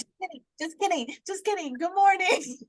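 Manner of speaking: high, singsong take-back; mock-innocent high voice; playful backtrack. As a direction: backtrack sweetly in a high little voice. Retells taking back a teasing message.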